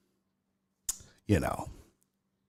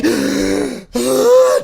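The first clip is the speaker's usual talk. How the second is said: loud, high, frantic exhale; panicked shouted sigh; 5 dB louder than usual. Retold or imitated. Patient mocking her own frantic breathing for the doctor.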